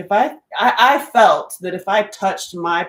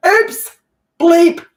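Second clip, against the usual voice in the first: much higher and louder, a mock-startled oops; playful oops; 11 semitones higher, 10 dB louder than usual. Real, not acted. After swearing to viewers, playful loud oops. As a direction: catch yourself after the swear word.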